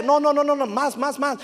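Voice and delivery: high-pitched rapid repeated protests; imitated frantic climber. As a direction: play a climber calling out in panic. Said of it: "le empieza a gritar a sus compañeros".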